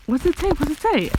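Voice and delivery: high, insistent repeated question; impatient. A narrator's reading. Anna wants the note read out, impatient. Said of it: "Anna said impatiently".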